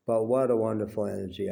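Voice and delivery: ordinary talk, nothing marked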